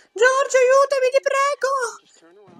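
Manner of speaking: put-on high, pleading girl voice; high girl's cry for help. Voicing a girl calling for help.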